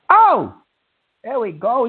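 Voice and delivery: ordinary talk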